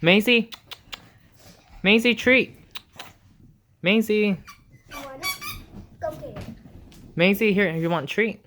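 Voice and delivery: loud, high call across the room; sing-song call to a pet; calling the dog. Real, not acted. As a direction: call her name so she comes running.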